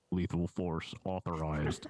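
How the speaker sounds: low, slow robot voice; flat robotic announcement. Shown as a demonstration. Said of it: "I wish I had my robot voice set up".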